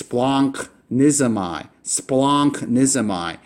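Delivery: splanchnizomai said slowly as splahnk-NEE-zo-my; slow teacher model of splanchnizomai; Greek pronunciation. Shown as a demonstration. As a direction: sound out splanchnizomai with the nk cluster. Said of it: "hard to pronounce in Greek".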